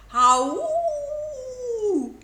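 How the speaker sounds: long high wolf howl; drawn-out high wolf howl. A narrator's reading. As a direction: hold the howl out proudly. Wolf howls with delight.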